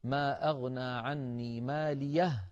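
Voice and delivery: slow chanted recitation; Quranic recitation. Shown as a demonstration. Teacher reciting the verse, slow chant. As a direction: chant ما أغنى عني ماليه slowly. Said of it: "يقول ذلك الرجل".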